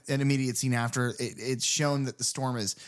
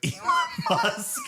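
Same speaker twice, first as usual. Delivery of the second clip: cracking up; slower than usual. Real, not acted. Host cracking up at their own Chucky comparison, laughing. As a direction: burst out laughing on the name.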